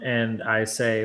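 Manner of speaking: ordinary delivery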